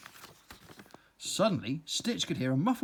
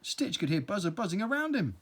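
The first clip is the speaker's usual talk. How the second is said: plain reading voice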